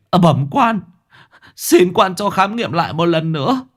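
high, urgent begging of a grieving father; desperate pleading. Acted. Grieving father clings to the magistrate's leg, begging in a high voice. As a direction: plead with the magistrate for another examination.

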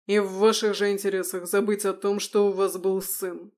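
loud, hard declaration; cold fury. Acted. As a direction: lay down the final word. Son cuts off his parents.

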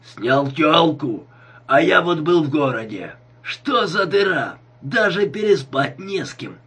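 put-on noisy, stretched drunken complaining; drunken yelling. A narrator's reading. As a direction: holler it like a boozed-up neighbour. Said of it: "заорал он".

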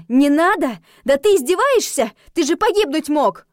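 high, loud outraged scolding; angry, mounting protest; seething indignation. A narrator's reading. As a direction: lash out in disbelief. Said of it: "а здесь шипела я. От негодования и злости".

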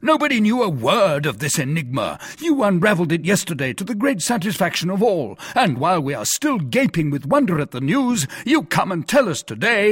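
high-pitched, mounting outrage; affronted, emphatic rant; indignant tirade. Acted. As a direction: rattle off grievances in theatrical disbelief. Porthos's indignant tirade at Aramis.

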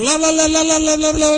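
high, unvarying la-la drone; monotonous singsong. Shown as a demonstration. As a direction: drone on like a dull preacher on one high note. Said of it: "el mismo sonsonete".

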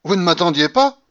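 loud, high, imperious grandmother voice; commanding babouchka who bursts in unannounced; old grandmother. A narrator's reading. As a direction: announce herself like a triumphant old matriarch. Grandmother taunts the family.

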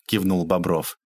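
plain reading voice

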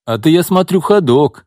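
high, eager and impressed; childlike admiration. Acted. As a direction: look up to him like a kid. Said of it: "с каким-то детским уважением заявил Дмитрий". (с детским уважением)

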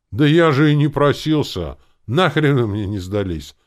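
good-natured boom. A narrator's reading. Gronk saying he never asked to join, booming. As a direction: boom it out with a grin. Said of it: "ухмыляясь, добродушно загудел".

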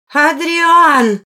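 high, loud call across rooms; shouted summons. A narrator's reading. An appalled woman calls the lord from the dining room, shouting. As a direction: yell the name to fetch him.

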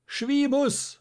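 very high, loud drawn-out call; shout. A narrator's reading. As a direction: yell the name across the crowd. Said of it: "schrie da Schierling". (lauter, hoher Ruf des Namens)

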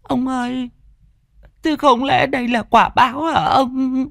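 mếu máo, giọng vợ cao hẳn; whimpering. A narrator's reading. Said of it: "mụ mếu máo". (vợ tủi thân, mếu máo hỏi chồng)

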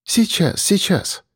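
quick reassuring repetition; fussing hostess. A narrator's reading. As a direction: murmur it while busy helping. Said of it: "приговаривала Маришка".

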